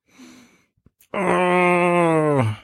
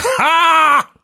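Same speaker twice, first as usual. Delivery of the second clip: sharp high villain "Ha!"; evil "Ha!"; 4 dB louder than usual. Shown as a demonstration. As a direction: bark out a gleeful "Ha!". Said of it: "I'm a fan sometimes of just".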